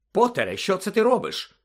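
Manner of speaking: sharp, high alarmed question; a nurse catching a patient climbing out of bed, alarmed; startled scolding. A narrator's reading.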